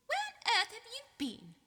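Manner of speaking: high, loud, indignant portrait lady; high-pitched matronly scolding; scolding fat lady. A narrator's reading. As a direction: demand an answer like a shocked matron. Fat Lady voiced by narrator.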